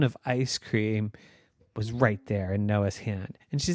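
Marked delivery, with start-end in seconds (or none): none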